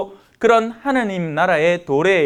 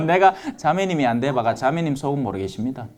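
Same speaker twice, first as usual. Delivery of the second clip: ordinary talk, nothing marked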